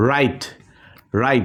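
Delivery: রাইট said as "rait", no w sound at the start; রাইট read like "right"; রাইট with silent w. Shown as a demonstration. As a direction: say রাইট cleanly with no w, the first sound an r. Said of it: "ডাব্লিউটা কিন্তু silent হয়ে গেছে".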